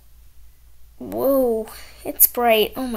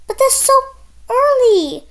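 whiny protest; 11 semitones higher, 8 dB louder than usual. Acted. Kid protests early bedtime.

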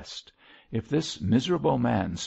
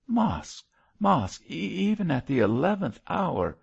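high, imploring clergyman; anguished appeal; urgent pleading; 9 semitones higher, 3 dB louder than usual. A narrator's reading. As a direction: plead earnestly, stumbling with urgency. Priest pleads with unrepentant condemned man.

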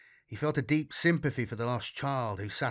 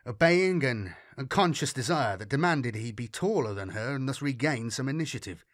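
plain reading voice

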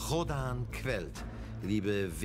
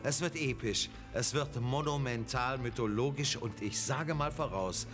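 announcer; 5 semitones lower than usual. Acted. Grand announcer intro.

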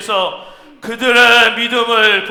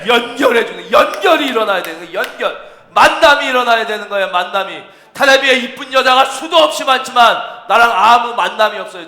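raised, emphatic pulpit delivery; forceful preaching; 5 semitones higher, 8 dB louder than usual. Real, not acted. Preacher teaching that living faith must act, forceful. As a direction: drive the point home to the congregation.

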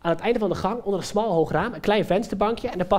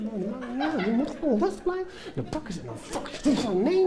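soft, agitated muttering leaping in pitch; frantic self-talk; 5 semitones higher, 5 dB quieter than usual. Acted. Performer battles himself to stay calm, frantic muttering. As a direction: argue with yourself, half rage, half restraint.

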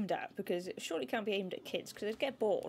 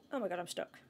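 ordinary delivery

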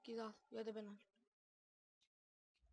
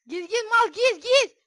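very high, very loud urging; frantic shouting; 10 semitones higher, 17 dB louder than usual. Real, not acted. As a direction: yell at the friend to jump in. Kavgada bağırarak yönlendirme. (çılgınca bağırma)

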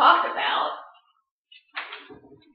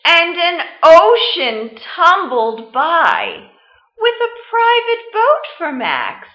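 story reading; 7 dB louder than usual. Acted. Teacher reading Where the Wild Things Are to the class, storytime voice. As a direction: read it like storytime, letting big moments swell. Teacher reads picture book aloud.

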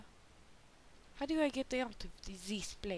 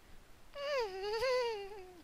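high, drawn-out whinnying laugh; horse-like laugh; 13 semitones higher than usual. Pretend. Player fooling around, high horse-like laughing noise. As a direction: let out a long horsey whinny. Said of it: "Why do you sound like a horse".

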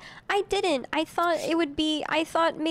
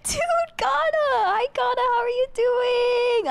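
high, loud, delighted hello to a friend; squealing happy welcome; excited greeting; 10 semitones higher, 4 dB louder than usual. Real, not acted. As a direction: cry out her name with glee.

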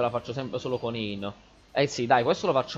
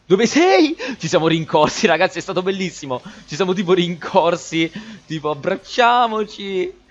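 grido di richiamo, acuto e forte; eccitato; 6 semitones higher, 8 dB louder than usual. Real, not acted. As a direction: call out loud and high, overexcited. Calls out to a friend excitedly. (eccitato, urlando di gioia)

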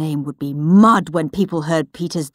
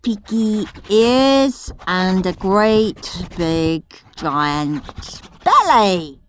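put-on gleeful cheeky boy; 4 semitones higher, slower than usual. Henry's doctored diary read as a slow, gleeful cheeky boy. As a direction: savour every naughty word.

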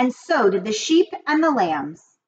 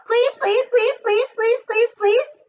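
much higher, chanting gosling pleas; teasing goslings; 13 semitones higher than usual. A narrator's reading. Seven goslings pester their mother, high teasing chant. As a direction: nag over and over like little goslings. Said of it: "began teasing to go".

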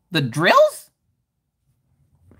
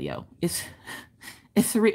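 normal talking voice

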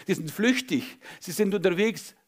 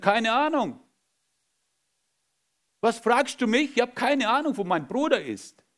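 defiant denial; 7 semitones higher than usual. Acted. Cain denies to God.

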